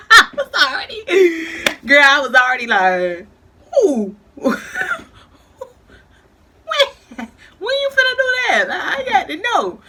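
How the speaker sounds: high, loud, laughing disbelief; laughing hard. Real, not acted. Friend laughing while retelling the threat. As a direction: cackle through the words, way up high.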